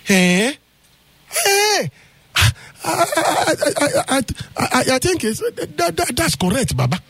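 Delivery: high startled stammering; shocked stammer. Acted. Husband reacts to the elder's news, shocked and stammering high. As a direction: jump up in pitch, lost for words.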